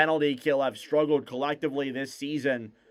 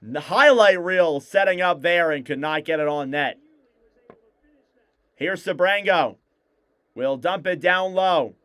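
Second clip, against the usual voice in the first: play-by-play; 4 semitones higher, 4 dB louder than usual. Acted. Live hockey play-by-play.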